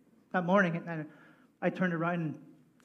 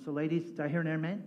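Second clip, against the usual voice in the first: ordinary talk, nothing marked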